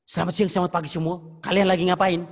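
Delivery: loud, harsh greeting that drives people away; greeting shouted rudely, not welcoming. Shown as a demonstration. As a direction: bark the hello like you want them gone. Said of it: "itu ngusir orang".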